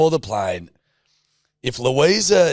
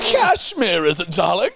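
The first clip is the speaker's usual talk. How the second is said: high, hammed-up British upper-crust query; mock British aristocrat; 7 semitones higher, 3 dB louder, slower than usual. Answering Louisa in kind, mock British aristocratic accent. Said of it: "very distinct British aristocratic accent".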